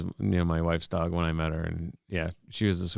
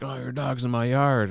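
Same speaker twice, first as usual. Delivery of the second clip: higher-pitched imitation of a neighbor complaining; mock neighborly complaint, pitched up; 6 semitones higher than usual. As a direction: lightly mimic a fussy neighbor. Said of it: "all the neighbors are like".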